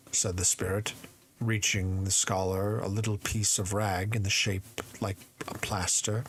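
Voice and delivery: ordinary delivery, nothing marked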